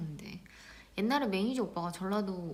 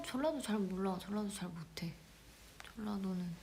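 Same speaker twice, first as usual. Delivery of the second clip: whispered confession, barely voiced; hushed aside; 8 dB quieter than usual. Real, not acted. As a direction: mutter it under her breath. Whispered aside about dialect.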